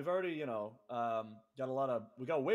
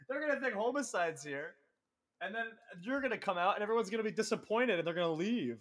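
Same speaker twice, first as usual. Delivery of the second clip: higher-pitched, insistent prediction; retold warning; 7 semitones higher than usual. Promoter warns wrestlers of a disappointed crowd, insistent warning. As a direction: spell out the coming letdown, a little amused.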